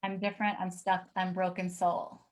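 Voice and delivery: reading the words while fighting tears; holding back tears. Real, not acted. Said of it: "'cause I'm trying not to cry".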